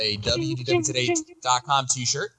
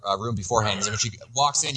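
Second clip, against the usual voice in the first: ordinary talk, nothing marked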